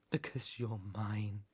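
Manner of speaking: low, very soft claim; hushed possessiveness. Acted. Captor murmurs ownership to rabbit, hushed possessiveness. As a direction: drop nearly to a whisper.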